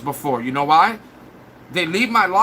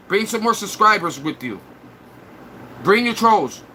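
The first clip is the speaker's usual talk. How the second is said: ordinary talk, nothing marked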